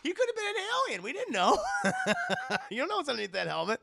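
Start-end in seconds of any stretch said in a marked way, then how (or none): 1.6-2.6 high cackling laugh. Real, not acted. Laughing hard.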